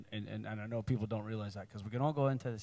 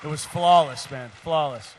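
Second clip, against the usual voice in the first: excited praise; 3 semitones higher, 11 dB louder, slower than usual. Real, not acted. Praising co-star, emphatic.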